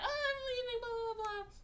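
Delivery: very high, mock-crying voice; mocking whine. Viewer mimics the woman who is leaving, mocking whine.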